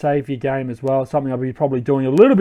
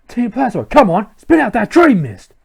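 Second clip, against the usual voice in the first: higher, forceful henchman demand; aggressive villain; 8 semitones higher, 5 dB louder than usual. Acted. Villain forcing Dream Mist from a Pokemon, aggressive.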